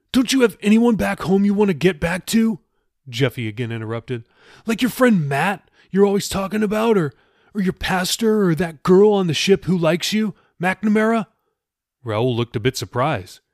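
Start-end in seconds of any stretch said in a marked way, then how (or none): 0.1-2.6 high, louder pleading question; Jeffy, urgent. Acted. Jeffy interrupts Raoul about home, urgent and high. 4.6-11.3 high, eager listing; Jeffy, prodding. Acted. Jeffy lists people Raoul would miss, prodding and high.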